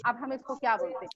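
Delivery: ordinary delivery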